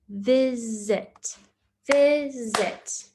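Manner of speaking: "Visit" split into two clear beats; clapped syllables. Shown as a demonstration. Teacher claps "visit" in two beats for pupils. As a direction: clap "Visit" out as vi-sit. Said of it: "How many claps did you hear?".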